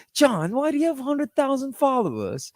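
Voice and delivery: put-on a clumsy try at an English accent; bad British accent. Host mimics the guest's British accent as his ex, mock British accent. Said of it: "I was trying to get your accent there".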